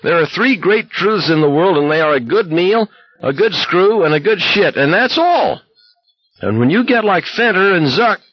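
high ranting blare; blaring. A narrator's reading. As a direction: keep blaring the rant. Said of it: "Olson was blaring".

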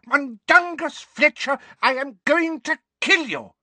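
high, loud furious shriek; furious old woman. A narrator's reading. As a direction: bellow for the missing man. Figg yells at absent man.

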